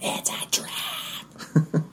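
very slow, stretched-out stage whisper; whispered warning. Pretend. Player warning party of a lure, drawn-out whisper. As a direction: whisper it like an urgent alarm, drawing out every word.

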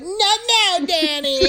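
high-pitched "not now"; high mock snap. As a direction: squeak it out dismissively. Kara snaps at Danny.

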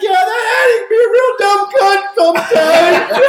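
high, loud, slow words through laughter; laughing mock biker. Biker agreeing about Daddy, high and laughing. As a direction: laugh the line out like a rowdy biker.

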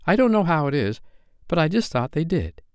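put-on high, eager child's voice; excited. A narrator's reading. Curly breaking into Wendy's story, excited. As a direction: keep the excited interruption going. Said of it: "Curly broke in excitedly".

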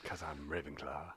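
mock grand declaration. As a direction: drop the voice low for a mock-dramatic boast. Dramatic repeat of Ravenclaw claim.

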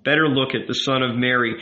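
ordinary delivery, nothing marked

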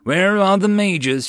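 loud, high, sharp demand. Acted. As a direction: fire the question like an order. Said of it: "she demanded".

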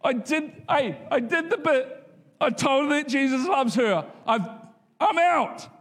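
high, whiny mock protest; hammed-up whiny refusal; childish protest. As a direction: plead like a kid wanting out. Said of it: "I'm like".

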